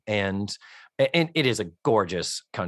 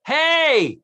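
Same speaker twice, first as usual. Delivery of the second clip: high, loud holler for attention; calling out; 5 dB louder than usual. Acted. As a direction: shout down to people below. Voicing a character calling for help.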